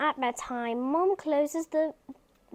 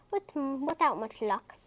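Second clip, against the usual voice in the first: ordinary delivery, nothing marked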